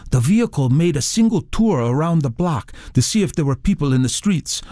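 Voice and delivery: lightly voiced young man giving his account; young eyewitness. A narrator's reading. Young eyewitness telling the author what he saw, lightly voiced and earnest.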